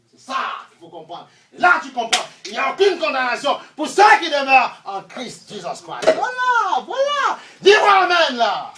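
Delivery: full-force preaching. Acted. Preacher calling the congregation to answer amen, shouted preaching. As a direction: cry it out and demand an amen.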